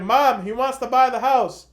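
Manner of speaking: imitating a sister hollering to mom; calling out. Sister calls out to her mom.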